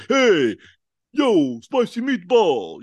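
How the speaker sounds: loud, high cartoon Italian accent; big drawn-out Italian-American caricature; mock Italian accent. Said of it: "'Cause he's not Italian".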